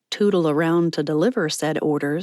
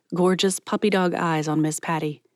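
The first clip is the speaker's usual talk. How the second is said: usual speaking voice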